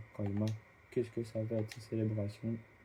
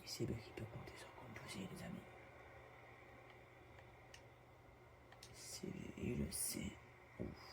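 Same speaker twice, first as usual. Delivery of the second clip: whispering; 17 dB quieter than usual. Real, not acted. Streamer whispering to viewers.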